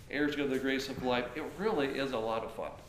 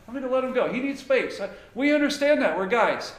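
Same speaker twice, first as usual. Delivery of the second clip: raised and louder, matter-of-fact buddy tone; hearty guy voice; 8 semitones higher, 9 dB louder than usual. Retold or imitated. One guy explains letting his buddy cool off, lightly hearty. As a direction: voice a guy cheerfully backing off.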